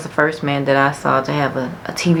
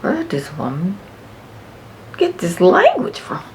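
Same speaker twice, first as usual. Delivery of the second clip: shocked onlooker; 4 semitones higher than usual. Pretend. Listener shocked by her cursing, high mock disbelief. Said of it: "you'll be looking like".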